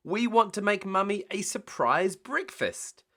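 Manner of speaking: high-pitched excited little pig; eager, high and bouncy character voice. A narrator's reading. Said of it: "said Peppa excitedly".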